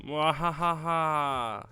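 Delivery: slow theatrical villain cackle; evil laugh. Acted. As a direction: cackle like a cartoon mastermind.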